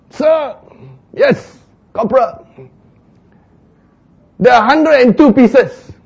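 high, loud dutiful report; soldier reporting. Acted. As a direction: report like an eager private.

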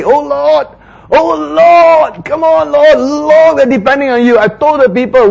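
high, loud pleading cry; pleading Moses. Acted. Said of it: "Like he's say".

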